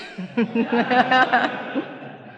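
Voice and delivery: a real laugh at her own caught lie; rueful laugh. Real, not acted. Remembering the counselor's letter, a real rueful laugh. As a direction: laugh it off, a bit embarrassed.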